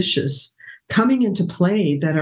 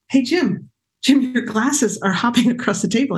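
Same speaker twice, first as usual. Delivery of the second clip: higher-pitched, incredulous call to a husband; retold amazed call; 6 semitones higher than usual. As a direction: call out in amused disbelief. Calls husband to look.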